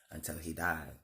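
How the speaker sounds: ordinary delivery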